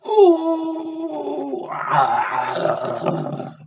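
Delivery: high, loud gasp of fright; a big mock fright cry; startled cry. Reading to children, hammed-up frightened cry at the wolf.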